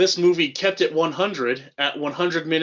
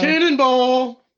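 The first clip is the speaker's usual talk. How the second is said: slow, high, drawn-out yell; cannonball yell; 8 semitones higher, 5 dB louder, slower than usual. Pretend. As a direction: yell it as you leap. Yelling while jumping down slide.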